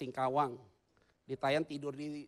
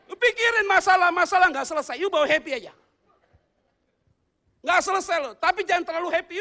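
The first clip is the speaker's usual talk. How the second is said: loud, high-pitched exhortation; raised, punchy preacher voice; forceful preaching; 11 dB louder than usual. Real, not acted. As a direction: drive it home to the congregation.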